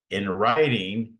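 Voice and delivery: "In writing" slow and loud, each word weighted; strong emphasis. Real, not acted. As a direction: stretch out "In writing" and punch it. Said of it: "I can't emphasize".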